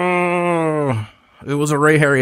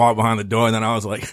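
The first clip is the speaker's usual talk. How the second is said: usual speaking voice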